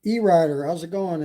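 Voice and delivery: ordinary delivery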